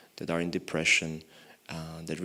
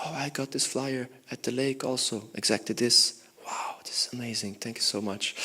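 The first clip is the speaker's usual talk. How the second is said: amazed delight; 5 semitones higher than usual. Retold or imitated. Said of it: "and she said".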